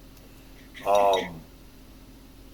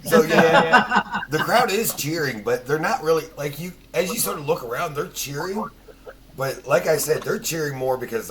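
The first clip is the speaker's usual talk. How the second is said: laughing; 7 semitones higher, 5 dB louder than usual. Real, not acted. GM laughing while narrating crowd.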